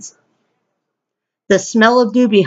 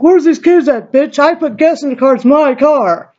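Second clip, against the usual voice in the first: higher, worked-up quarrelling voice; mock angry demand; 4 semitones higher than usual. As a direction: imitate an angry man, pitched up. Mimics him in a quarrel.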